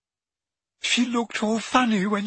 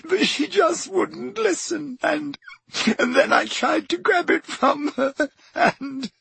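much higher, tearful, breaking voice; distraught girl; 11 semitones higher than usual. A narrator's reading. Leanne telling friends what happened, crying. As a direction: cry it out in panic.

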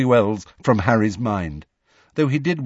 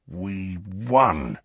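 drawn-out, savouring each word. A narrator's reading. Ron relives the Quidditch win, savouring the words. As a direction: linger with relish on both words. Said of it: "savouring the words".

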